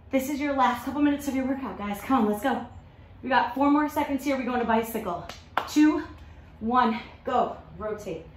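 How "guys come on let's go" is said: ordinary talk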